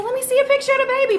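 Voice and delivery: insistent repeat. Retold or imitated. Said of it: "I said".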